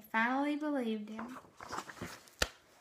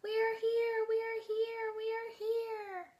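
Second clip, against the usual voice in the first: high, soft little chorus voices; teacher showing her drawing, high small Who voices; tiny Whos shouting; 10 semitones higher, 4 dB quieter than usual. Retold or imitated. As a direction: call it like tiny far-away Whos. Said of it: "the who's were shouting".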